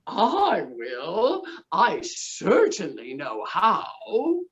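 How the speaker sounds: low, slow lowing cow; a smug cow mooing. Acted. Cow wanting dinner, low and slow. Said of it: "said the cow".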